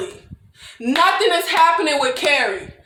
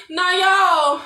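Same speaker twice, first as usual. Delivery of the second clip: usual speaking voice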